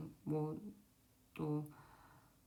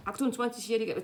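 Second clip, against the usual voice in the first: loud, raised-pitch automatic answer; instant memorized recital; 5 semitones higher, 7 dB louder, faster than usual. Acted. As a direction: blurt it straight out from memory. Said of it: "탁 나올 정도로".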